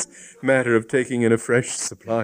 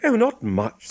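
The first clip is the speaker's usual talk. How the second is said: put-on young woman voice; 13 semitones higher than usual. A narrator's reading. Hypatia voiced by narrator.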